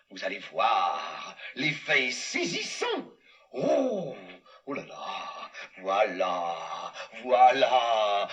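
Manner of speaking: low slow spooky moan; haunt them from under the sheet; deep, slow ghostly voice. Acted.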